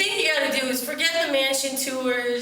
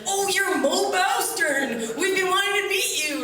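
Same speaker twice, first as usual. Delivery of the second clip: much higher, slow gushing welcome; eager fisherman meeting a newcomer, high and gushing; excited greeting; 9 semitones higher, slower than usual. Retold or imitated. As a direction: gush like a thrilled fan. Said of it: "he came up to me and he's like".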